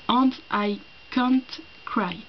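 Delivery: very slow English with a strong French accent. Shown as a demonstration. A booktuber reads Tom's English line, in a slow French accent. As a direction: lets the line sink slowly, in a French accent. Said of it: "mon accent, euh, anglais est tout pourri".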